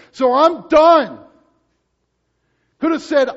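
loud, high voicing of Christ; fervent pulpit climax; preaching at full force. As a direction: proclaim what he could have said.

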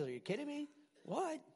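high, soft incredulous aside; mock disbelief. Preacher marveling to the church at a single command, high soft disbelief. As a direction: toss it off like you can't believe it's that simple.

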